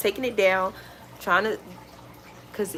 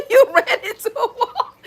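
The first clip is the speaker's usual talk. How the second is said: laughing; 14 semitones higher, 5 dB louder than usual. Real, not acted. Laughing at someone.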